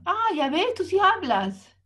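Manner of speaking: mock psychologist pleased with herself; smug psychologist. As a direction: gotcha tone. Psychologist's triumphant reply.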